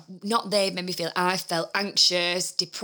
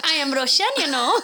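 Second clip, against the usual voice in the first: higher, giggly mock Russian; Russian accent; 5 semitones higher, 4 dB louder than usual. Joking with co-host about being a rusher, dodgy Russian accent. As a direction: attempt a Russian accent through a laugh. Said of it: "I don't think that's a Russian accent".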